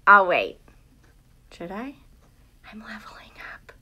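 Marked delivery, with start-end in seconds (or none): 1.5-3.7 fast breathy whisper to herself. Real, not acted. Teacher whispering to herself while leveling up on GoNoodle. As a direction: murmur it under your breath, all air.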